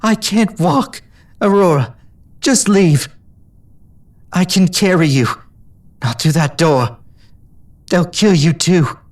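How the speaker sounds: high, slow tearful pleading. A narrator's reading. Injured mare urging friend to flee, tearful. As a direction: beg her to leave through tears. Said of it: "eyes were awash with tears".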